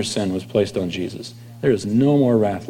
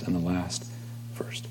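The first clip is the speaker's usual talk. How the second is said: hushed whisper; 5 semitones lower, 18 dB quieter than usual. Real, not acted. Preacher reading scripture to the congregation, whispering. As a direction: whisper the last words of the verse.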